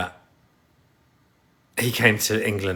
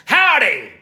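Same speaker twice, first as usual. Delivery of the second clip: high, loud surprise American howdy; American accent; 6 dB louder than usual. Pretend. Streamer surprising viewers as an American, loud high mock twang. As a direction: burst in with a mock American holler. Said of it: "You thought an American person had come on my live".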